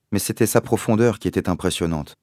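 ordinary delivery

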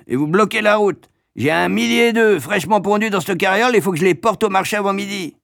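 high, loud, agitated complaint; worked-up carter in a hurry; indignant egg carter. A narrator's reading. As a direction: protest like a tradesman held up on the road.

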